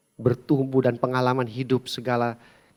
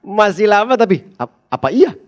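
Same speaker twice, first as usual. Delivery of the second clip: nervous reassurance; 4 semitones higher, 8 dB louder than usual. Retold or imitated. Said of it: "Dengan sedikit khawatir".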